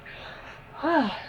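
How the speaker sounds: long breathy yawning sigh; big yawn. Real, not acted. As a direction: let out a real yawn. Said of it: "I'm gonna yawn again".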